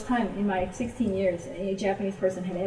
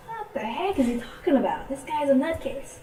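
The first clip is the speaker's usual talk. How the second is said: higher-pitched mock disbelief; incredulous reaction; 6 semitones higher than usual. Listener privately reacting to the artist's odd advice, incredulous. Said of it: "And I was just like".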